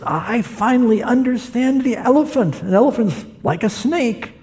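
preacher voicing a blind man, pitched high.

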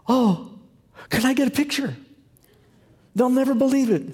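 high, excited pleading; a young lady in the elevator begs for a photo, excited; excited fan. Retold or imitated. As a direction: gush like meeting a celebrity.

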